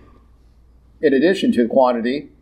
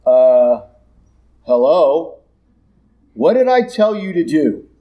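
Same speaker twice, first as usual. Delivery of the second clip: exasperated God. Acted. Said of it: "God looks at Moses and goes".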